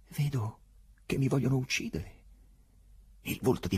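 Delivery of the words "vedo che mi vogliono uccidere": higher, anxious plea; uneasy voice. Acted. Prisoner sensing they want to kill him, higher uneasy voice. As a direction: let worry creep into the voice. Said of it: "e la sua voce divenne inquieta".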